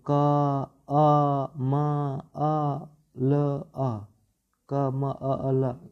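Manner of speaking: कमल broken into क, अ, म, अ, ल, अ with pauses; letter by letter. Shown as a demonstration. Teacher showing students the wrong, letter-by-letter reading. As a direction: sound out each letter separately, deliberately wrong. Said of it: "इस तरह ऐसे पढ़ेंगे तो कहाँ अच्छा लगेगा?".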